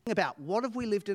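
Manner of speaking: ordinary talk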